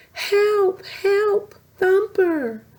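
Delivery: high, loud frightened cries; little sisters drifting away, crying for help; cried for help. Acted. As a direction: call out in panic. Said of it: "four little voices suddenly cried".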